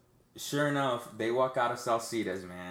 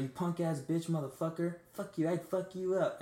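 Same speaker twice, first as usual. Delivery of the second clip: retold cursing in a raised pitch; mimicked hooker threat; 6 semitones higher than usual. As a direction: imitate an angry hooker's threats. Hooker threatens him in story.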